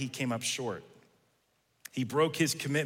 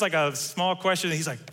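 ordinary talk, nothing marked

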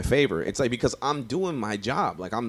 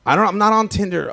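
laughing denial; 12 semitones higher, 8 dB louder than usual. Real, not acted. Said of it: "strong Tinder game".

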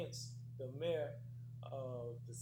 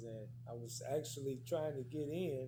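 ordinary talk

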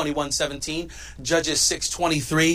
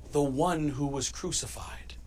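whisper; 4 semitones lower, 9 dB quieter than usual. Real, not acted. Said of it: "I missed a part".

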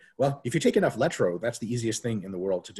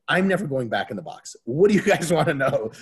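louder, laughing mock surrender; giving in laughing; 4 semitones higher, 5 dB louder than usual. Broken prisoner caving to interrogators, laughing surrender. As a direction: crack up as you cave in.

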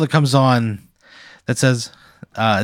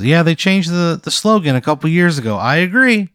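nervous TV pitchman; 7 semitones higher than usual. Acted. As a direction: play an awkward local TV salesman. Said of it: "And he goes".

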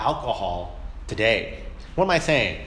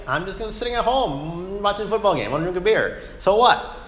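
higher, casual "so what" voice; mock carefree sports fan with a beer; careless shrug; 7 semitones higher than usual. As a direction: toss it off like nobody could object. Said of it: "Christians who take this kind of attitude of".

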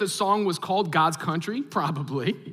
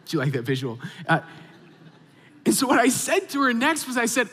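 usual speaking voice

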